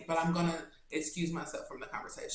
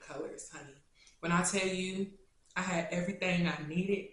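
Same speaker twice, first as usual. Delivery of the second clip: tearful. Real, not acted. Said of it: "I better not cry".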